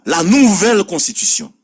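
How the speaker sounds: emphatic declaiming. Acted. Radio host declaiming opener.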